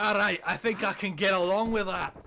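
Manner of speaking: gruff Scottish dwarf quote, hammed up; bad Scottish accent. Reading the card's quote in a terrible Scottish accent.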